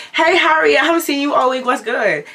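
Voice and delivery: high, loud breezy hello acted out; friendly greeting. Teller greeting Harriet at the front door, high friendly hello.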